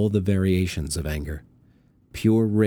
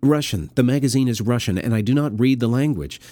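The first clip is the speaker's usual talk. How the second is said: desperate insistence; 5 semitones higher, 4 dB louder than usual. Acted. Milos desperately keeping Eva on line.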